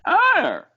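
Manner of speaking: high, loud imitated dog whine. Recalling his dog's thirsty whine, a high imitated yelp. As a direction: yelp it like a dog trying to say water. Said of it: "kinda whined".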